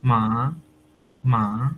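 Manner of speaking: rising second-tone মা. Shown as a demonstration. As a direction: lift মা upward. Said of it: "নিচের থেকে উপরে যায়".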